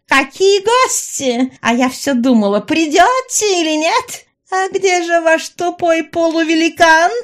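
high, loud taunting villainess; Раяна, mocking. A narrator's reading. Villainess taunts the intruders who came for her, mocking. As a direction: draw out the jeer in a high-pitched voice. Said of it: "Раяна расхохоталась".

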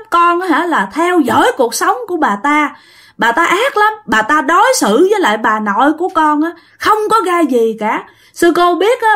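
put-on high, plaintive, accusing child; child spirit's voice. Child spirit denouncing the woman to the nun, plaintive child voice. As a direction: complain bitterly like a hurt little child. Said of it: "giọng giống như là một đứa con nít".

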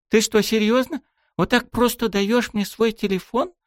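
put-on high, astonished girl's question; disbelieving young girl; surprised. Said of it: "Удивлённо уставилась".

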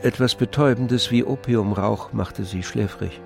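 ordinary talk, nothing marked

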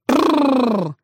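rider's trilled cry to a horse; yelled whoa trill. A narrator's reading. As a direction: roll out a long prrr to stop a horse. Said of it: "Всадник заорал".

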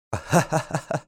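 high-pitched acted cackle; gleeful guffaw. A narrator's reading. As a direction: burst into delighted laughter. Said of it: "قهقه المساعد في فرح". (قهقهة فرحة)

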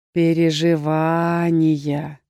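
low, slow, deep troll voice, kindly; sympathetic troll. A narrator's reading. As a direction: say it gently like a caring troll. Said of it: "сказал участливо". (участливо, низким голосом тролля)

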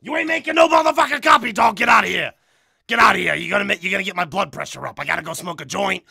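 shouted, blustering character rant; impression of loud ranter. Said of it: "That's how he sounds, dude".

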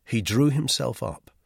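plain reading voice

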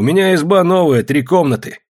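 high, loud, proud offer; hearty boasting. A narrator's reading. Neighbour offers his new three-room house, hearty boasting. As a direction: talk it up loudly to win them over. Petrovan presses his invitation.

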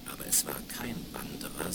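usual speaking voice

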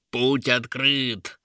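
slow, drawn-out creature cheer; triumphant monsters. A narrator's reading. Monsters celebrate the open path, drawn-out triumphant voice. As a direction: announce it slowly in monster triumph. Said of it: "зааплодировала нечисть".

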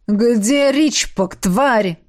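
slow, drawn-out angry demand; menacing demand. A narrator's reading. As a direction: stretch the words out with menace. Furious attacker demands answer.

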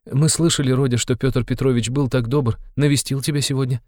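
high, tentative mother's voice; somewhat timid. A narrator's reading. Mother mentions the suitor's visit to her son, timidly. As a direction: broach it timidly, pitched high. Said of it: "с некоторой робостью прибавила Пульхерия Александровна".

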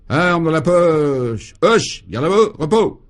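high, loud parade-ground bark; drawn-out order, then sharp high shouts; military command. A narrator's reading. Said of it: "il lança un nouveau commandement". (commandement militaire hurlé)

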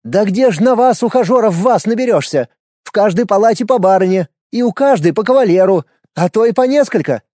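high, loud fussy nurse; scolding nurse. A narrator's reading.

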